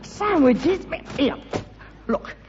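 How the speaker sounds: high, incredulous echo; scornful surprise. Acted. Son mocking his father's sandwich offer. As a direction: spit the word back in disbelief.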